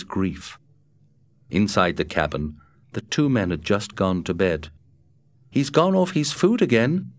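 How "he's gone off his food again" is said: higher, puzzled Matt voice. A narrator's reading. Matt puzzling over the wolf to Scott, higher and puzzled. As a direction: pitch Matt up, wondering aloud. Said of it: "Matt remarked from his bunk".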